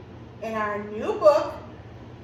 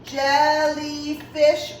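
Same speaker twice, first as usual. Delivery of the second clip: "jellyfish" slow in three even beats, jel-ly-fish; slow, level, separated "jellyfish"; clapped-out syllables; much slower than usual. Shown as a demonstration. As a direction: stretch out each syllable of "jellyfish". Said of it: "I hear three syllables".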